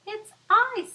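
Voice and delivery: high, loud squeaky fly; a tiny fly answering proudly. Acted. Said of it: "said the fly".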